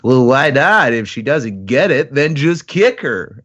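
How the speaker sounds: a hint of a snotty little boy, slowing on kick her; aloof little boy. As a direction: toss it off like a careless kid. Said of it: "an aloof little boy that he is".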